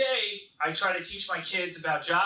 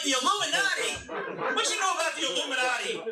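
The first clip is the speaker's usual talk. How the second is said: incredulous dad; 10 semitones higher than usual. Acted. Dad to his kids about the Illuminati, incredulous. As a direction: echo it back in high disbelief. Dad reacts to daughter's answer.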